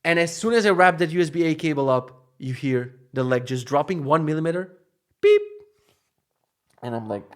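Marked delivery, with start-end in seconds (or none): 5.2-5.4 high, loud electronic beep; one piercing beep like an alarm; imitated alarm beep.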